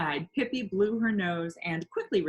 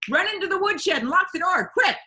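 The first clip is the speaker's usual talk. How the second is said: much higher, louder urgent warning; excited warning; 12 semitones higher, 9 dB louder than usual. A narrator's reading. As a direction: hurry her to safety. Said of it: "said Pippi excitedly".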